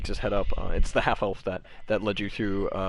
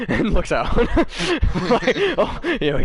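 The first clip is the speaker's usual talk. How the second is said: high, loud laughter breaking through; can't hold back the giggles; laughing; 14 semitones higher, 8 dB louder than usual. Real, not acted. Game master cracking up at the table, loud laughter. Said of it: "laughing through narration".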